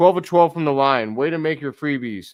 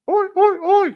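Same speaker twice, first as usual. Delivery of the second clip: squeaky high shouted Aussie chant; changed voice; 6 dB louder than usual. Acted. Streamer hypes the Aussie player for chat, squeaky high loud chant. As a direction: yell the oi chant in a much higher put-on voice. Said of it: "I tried to change my voice".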